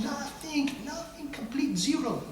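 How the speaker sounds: high, soft, drawn-out emphasis; hushed amazement. Real, not acted. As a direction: marvel softly at the total blank. Stressing total lack of recollection.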